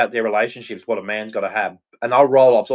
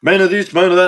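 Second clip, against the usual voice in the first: higher, louder sneering mimicry; mock newsreader; 6 semitones higher, 5 dB louder, faster than usual. Ranting about TV women, mocking high voice. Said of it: "talk like".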